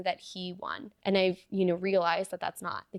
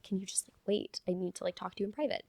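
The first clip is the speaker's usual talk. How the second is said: hushed private request; 6 dB quieter than usual. Retold or imitated. Asking proctor privately in bathroom.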